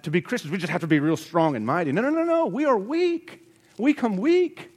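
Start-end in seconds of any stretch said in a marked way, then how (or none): 1.9-4.7 very high-pitched, louder protest; emphatic denial. Real, not acted. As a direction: push the voice way up to deny it. Rebutting notion of being strong.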